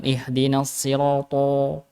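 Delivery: sirata slow with lip-rounded to; rounded to mistake. Shown as a demonstration. Teacher shows the wrong rounded to to class. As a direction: round lips on the to in Ihdinas sirata.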